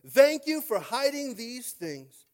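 high, loud, slow cry to the Father; Jesus rejoicing, praying loudly to the Father; loud joyful prayer. Acted. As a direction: lift it up, full of joy. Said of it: "And he said".

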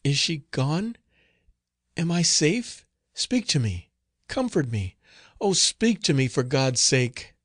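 high, anxious pleading in short bursts; frightened, urgent plea; trembling cry. A narrator's reading. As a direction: beg for comfort in fright. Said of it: "she cried in a trembling voice".

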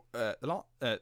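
usual speaking voice

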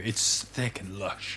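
sultry shampoo ad. Pretend. Pastor pretending to voice a shampoo commercial, slow and low. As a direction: drool over the hair like a shampoo ad. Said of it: "If I was doing a shampoo commercial".

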